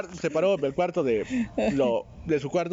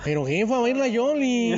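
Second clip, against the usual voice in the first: put-on slow, singsong northern Mexican accent; 5 semitones higher, slower than usual. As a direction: stretch baila Yoli like a northern Mexican. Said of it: "Tienes que hablar como norteño".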